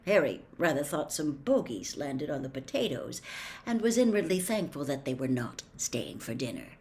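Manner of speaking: usual speaking voice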